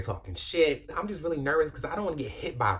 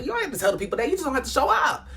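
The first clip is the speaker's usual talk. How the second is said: urgent; 13 semitones higher, 6 dB louder, faster than usual. Retold or imitated. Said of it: "I'm just like".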